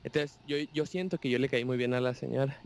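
ordinary delivery

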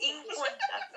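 laughing self-mockery. Real, not acted. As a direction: laugh the word out. Mocking themselves laughing. (riéndose de sí misma)

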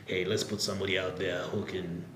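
normal talking voice

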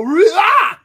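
sudden high shouted "Rah!"; startled yell. Retold or imitated. Realtor bursting in on a dark house, loud yell. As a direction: burst through the door with a loud high cry. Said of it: "like this".

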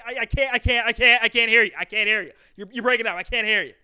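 loud, high, repetitive mock father; stuttering stern dad. Dad who can't hear son on phone, stuttering and stern. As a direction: stammer on I, then insist loudly. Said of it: "They stutter on I".

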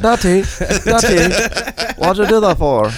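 put-on high, pestering kid voice; young daughter. Daughter questioning Daddy from the back seat, high child voice. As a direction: imitate a child calling for Daddy. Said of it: "my daughter's like".

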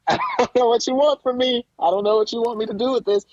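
high-pitched, bewildered plea; exasperated bafflement. Retold or imitated. Baffled viewer reacting to the show, high and exasperated. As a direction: throw it out high, at a total loss.

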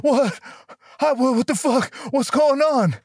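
a confused friend waking up mid-chaos; mock dazed friend coming to; dazed confusion. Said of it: "this motherfucker goes".

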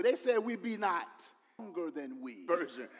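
loud, high, driving sermon voice; preaching at full force. Acted. Pastor preaching hard to the congregation about doubters. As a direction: shout it to the congregation.

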